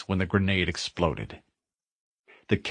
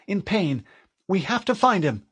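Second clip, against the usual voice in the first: female AI voice; 10 semitones higher than usual. Acted. Cortana worried about captain.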